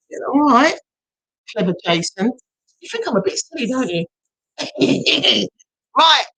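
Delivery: normal talking voice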